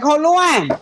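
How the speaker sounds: high, sudden hold on; Sam's protest. Retold or imitated. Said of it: "Sam was like".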